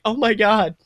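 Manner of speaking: excited. Acted. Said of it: "You're just like".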